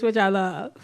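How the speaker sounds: ordinary talk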